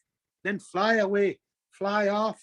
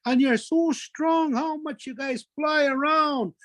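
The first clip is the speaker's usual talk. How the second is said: slow, higher-pitched gushing praise; laying on flattery; 5 semitones higher, slower than usual. Acted. Trickster rubbing it into the young birds, gushing flattery. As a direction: draw out the admiration to win them over. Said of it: "whiskey attack was just rubbing it into them".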